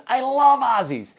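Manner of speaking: high, loud, drawn-out comic cry; Jerry Lewis. Retold or imitated. Comedian delighted to meet an Australian fan, high loud mimicry. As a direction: stretch it out in a squawking comedian impression. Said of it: "He goes".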